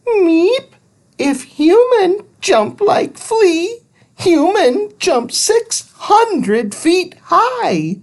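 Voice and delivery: very high squeak; squeaky creature. Acted. Thud squeaks mid-jump in a very high voice. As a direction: squeak out the fact like a tiny alien. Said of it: "Thud squeaked".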